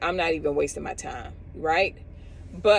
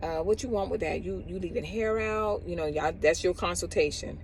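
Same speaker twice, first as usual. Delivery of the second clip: imitating a careless stylist; 7 dB quieter than usual. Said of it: "And she's like".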